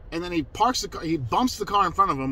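ordinary talk

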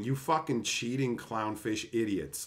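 ordinary delivery

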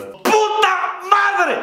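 long drawn-out high cry of rage; silent scream. Pretend. Fed-up rider on the bus, screaming inside. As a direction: stretch it out, way up high. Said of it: "en tu mente gritas".

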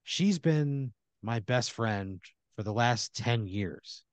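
very slow, lost in memory; faraway, wistful. Acted. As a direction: draw it out as if remembering a lost friend. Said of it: "a faraway look in his eyes".

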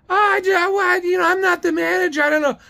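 high, stammering mock office lady; high caricature making excuses; Dee's high-pitched voice. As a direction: mimic a flustered clerk in falsetto. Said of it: "She has this high-pitched voice".

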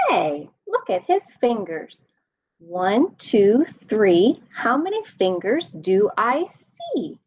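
lively sing-song read-aloud voice; animated bedtime story reading; reading to children. A narrator's reading. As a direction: read it bouncy for little kids.

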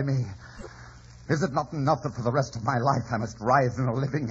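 rising anguished shout; anguished outburst. Acted. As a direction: rail at her in despair. Anguished outburst at dying Kathy.

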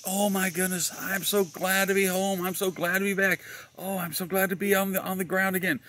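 high-pitched gushing relief; mock relieved squeal of a nervous woman; nervous lady passenger. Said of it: "the lady in front of me was quite nervous".

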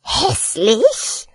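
slow, outraged rat voice; kreischende Ratte. Acted. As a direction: draw it out as a hurt, indignant rat. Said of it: "kreischte die Ratte". (empörte Ratte, gedehnt)

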